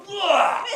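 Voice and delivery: a sudden high mock retch. Friend retching in the car, mock retch. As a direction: imitate a friend throwing up, high-pitched. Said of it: "he went".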